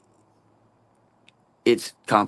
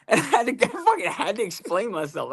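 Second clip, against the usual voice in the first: laughing; 10 semitones higher than usual. Real, not acted. Laughing at own embarrassing story.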